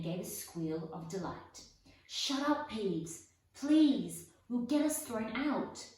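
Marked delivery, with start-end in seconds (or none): 2.1-5.9 loud, high desperate pleading; urgent plea. Acted. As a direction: beg him to shut up before they're caught. Begging Peeves to be quiet.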